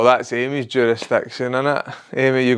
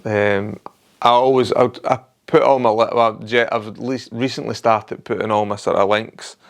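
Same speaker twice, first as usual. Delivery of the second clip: ordinary talk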